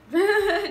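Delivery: loud, high burst of laughter; sudden high laugh; short laugh. Real, not acted. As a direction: let out a quick giggle. Laughs after listing siblings.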